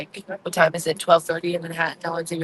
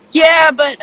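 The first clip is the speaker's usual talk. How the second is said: jolted answer, higher and louder; surprised reply to a friend's call; startled yeah; 9 semitones higher, 7 dB louder than usual. Real, not acted. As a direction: caught off guard, blurt it out. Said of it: "Joy, you there?".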